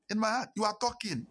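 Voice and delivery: high-pitched, incredulous inner retort; indignant inner thought. Retold or imitated. Inner indignation at being lectured.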